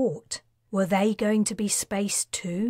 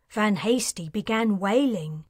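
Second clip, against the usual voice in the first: ordinary delivery, nothing marked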